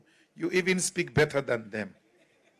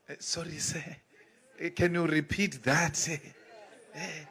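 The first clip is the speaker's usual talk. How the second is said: meek, much softer "sorry, sir"; submissive apology; 7 dB quieter than usual. Retold or imitated. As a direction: shrink down and beg pardon humbly. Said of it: "this submissive attitude".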